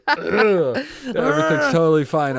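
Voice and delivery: high, loud, slow choked-up insistence; tearful denial. Real, not acted. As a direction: insist through tears that you are okay. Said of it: "that's not a tear going down my cheek".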